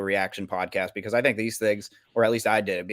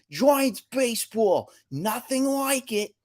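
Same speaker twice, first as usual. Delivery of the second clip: slow, high mock East Coast announcer; hammed-up Boston New York accent; 13 semitones higher, slower than usual. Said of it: "weird East Coast accent".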